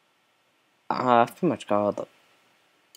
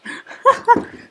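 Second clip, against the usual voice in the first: high giggle. Real, not acted. Laughing after amazed shout.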